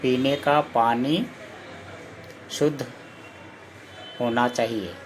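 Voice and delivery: word by word with long pauses, slowed right down; slow dictation. Shown as a demonstration. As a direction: dictate it slowly so pupils can write. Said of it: "जैसे कि". (धीरे-धीरे, रुक-रुक कर बोलना)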